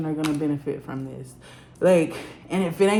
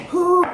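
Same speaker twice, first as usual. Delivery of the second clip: emotional whew; 12 semitones higher, 9 dB louder than usual. Real, not acted. Overwhelmed exhale mid reading.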